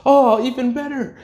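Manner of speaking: very high, delighted mock gasp of words; excited. Kid learns Mirage wrote the letter, excited. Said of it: "he's all excited".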